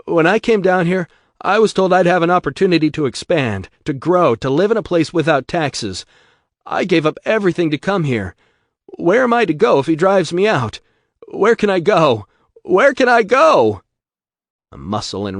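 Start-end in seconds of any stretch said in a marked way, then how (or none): 0.0-12.3 desperate plea. Acted. 12.6-13.8 desperate cry. Acted. Gravenstein's plea peaks.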